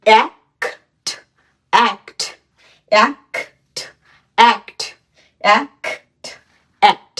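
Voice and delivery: অ্যাক্ট stretched slowly at first, then repeated; teacher modelling a word for students to copy; drilled pronunciation. Shown as a demonstration. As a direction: model অ্যাক্ট clearly for learners. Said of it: "এটির উচ্চারণ কি হবে".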